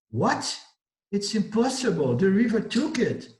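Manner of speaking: high, stunned outcry; disbelief. Acted. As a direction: cry out in shocked disbelief. Pilgrims disbelieve the sage's promise.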